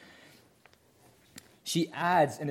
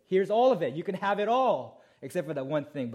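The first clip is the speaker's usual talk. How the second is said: higher and louder, open-handed; generous God; 5 semitones higher, 4 dB louder than usual. Retold or imitated. Preacher voicing God's generous offer, louder and higher. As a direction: offer it all with a big voice. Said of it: "He said".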